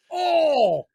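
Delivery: high loud thrilled exclamation; excited oh. Pretend. Imagining Superman beside Captain America, high excited cry. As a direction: shout it like the dream came true.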